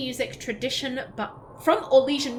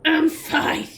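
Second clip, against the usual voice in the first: higher, louder, choked "I'm fine"; 5 semitones higher, 6 dB louder than usual. Real, not acted. Streamer fights tears after the song, voice strained. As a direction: insist "I'm fine" while tearing up.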